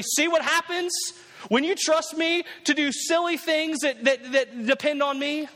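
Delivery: high-pitched taunting lecture; mock scolding. Preacher imagining Jesus gloating to the disciples in the boat, mock scolding. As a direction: crow it at onlookers, pitched well up.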